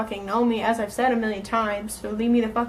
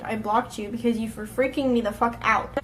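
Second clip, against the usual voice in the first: ordinary delivery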